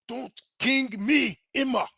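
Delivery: exaggerated African accent for dramatic effect; bad African accent. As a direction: lean into a hammy African accent, stretching each word. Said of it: "you gotta let the bad accent fly".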